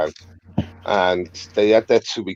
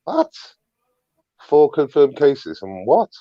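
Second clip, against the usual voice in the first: slow, incredulous, rising higher on each what; stunned disbelief; 5 semitones higher, slower than usual. Retold or imitated. Retelling his reaction to the footage, slow incredulous what. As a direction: play it as disbelief at the news, drawn out. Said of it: "I was like".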